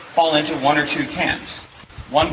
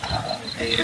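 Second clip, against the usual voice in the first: normal talking voice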